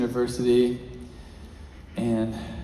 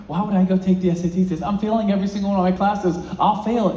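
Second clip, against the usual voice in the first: fast, higher, louder disbelief; protesting; 7 semitones higher, 4 dB louder, faster than usual. Retold or imitated. Failing student rejecting the teacher's request, fast and incredulous. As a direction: rush the objection, sure he'll fail.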